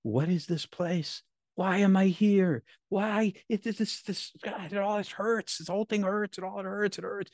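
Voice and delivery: pained and lost, pitched high; anguished confusion. Retold or imitated. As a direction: relive a bewildered, hurting plea. Reliving waking in pain.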